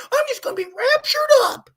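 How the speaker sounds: high mocking cry of the raptured. Preacher mocking believers, high whining cry. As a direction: whine it like a smug believer.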